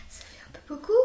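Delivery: breathy whisper, no voice at all; whispered aside. Real, not acted. Girl whispering to her viewers that nine is a lot. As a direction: let it slip out under your breath.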